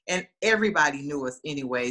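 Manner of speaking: ordinary delivery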